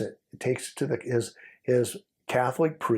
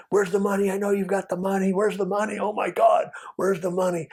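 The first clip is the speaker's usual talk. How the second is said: high, urgent repeated demand, pitch well up; frantic demand; 8 semitones higher than usual. Retold or imitated. Foreman shoving the undercover around the bathroom, frantic high demand. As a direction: grill him over the missing cash. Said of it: "We're hearing it".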